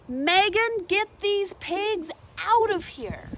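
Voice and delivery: high, loud, slow angry order; yelling principal. A narrator's reading. Principal shouts at Megan to remove the pigs, loud slow order. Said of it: "The principal yelled".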